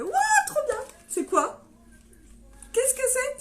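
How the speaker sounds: squealing high with a laugh; excited gasp. Retold or imitated. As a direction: relive the thrilled unboxing moment. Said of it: "j'ai fait".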